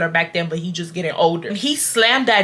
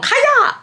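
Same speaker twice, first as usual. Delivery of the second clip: high mock karate yell; a playful martial-arts shout; karate cry; 3 dB louder than usual. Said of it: "No, let me stop playing".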